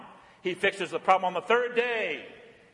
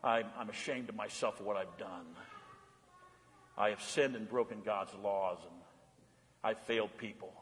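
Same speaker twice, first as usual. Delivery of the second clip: much softer, lower confession of shame; ashamed inner voice; 11 semitones lower, 12 dB quieter than usual. Retold or imitated. Preacher voicing a guilty sinner's thoughts, hushed and ashamed. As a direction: murmur it like someone ashamed of themselves. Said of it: "and we feel like".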